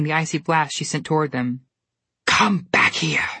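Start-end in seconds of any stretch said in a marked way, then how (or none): 2.3-3.3 slow Snow Queen command; cold demand. A narrator's reading. Snow Queen chasing the twins, slow and commanding. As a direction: call after them, drawn out. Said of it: "she demanded".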